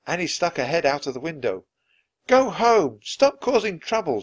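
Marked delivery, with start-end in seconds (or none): 2.3-4.2 put-on high, scolding child's yell. A narrator's reading. Annie out of the window to the Vikings, yelling. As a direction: yell them off. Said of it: "she yelled to the Vikings".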